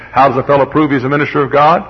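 usual speaking voice

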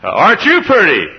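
put-on beam down at a little girl; old preacher greeting a child. Old preacher cooing at a little girl, high and loud. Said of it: "And he said".

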